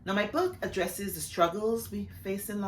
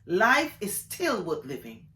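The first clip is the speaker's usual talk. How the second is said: poem recitation; 3 dB louder than usual. Acted. Author reading her poem Optimism to an audience, measured poetry recitation. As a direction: recite with lifted openings and falling line ends.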